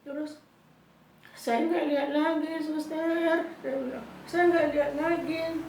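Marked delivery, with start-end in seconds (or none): 1.3-3.4 high, loud panicked cry; re-enacted distressed shout. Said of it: "Saya berteriak". 4.3-5.7 high, loud cry of alarm; re-enacted distressed shout. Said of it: "Saya berteriak".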